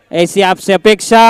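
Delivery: normal talking voice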